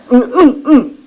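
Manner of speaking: high, short pleading groans; groaning prayer. Shown as a demonstration. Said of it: "아버지란 뜻입니다". (높은 음으로 짧게 신음하듯 기도)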